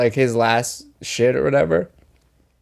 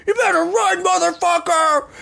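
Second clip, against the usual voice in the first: loud booming yell. Retold or imitated. Friend shouting at the man from the car, loud yell. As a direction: holler a threat after someone, imitating. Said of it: "he said it so loud".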